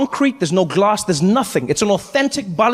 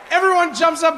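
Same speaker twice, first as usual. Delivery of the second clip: normal talking voice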